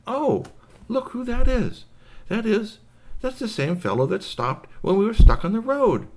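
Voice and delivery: high-pitched alien recognition; excited little space creature; surprised alien. Acted. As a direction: exclaim it up high, amazed to recognise someone.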